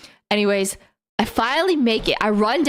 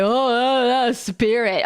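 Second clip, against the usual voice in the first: snooty heckler; 2 semitones lower, slower than usual. Retold or imitated. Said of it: "gonna be like".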